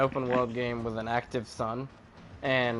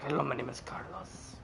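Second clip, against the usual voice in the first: put-on Carlos accent. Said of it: "he did a Carlos accent".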